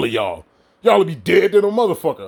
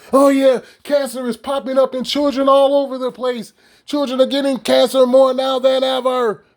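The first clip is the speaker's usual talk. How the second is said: higher, mock-alarmed reporter voice; mock news report; 8 semitones higher than usual. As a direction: parrot the headlines mockingly, pitched up. Mocking news about children.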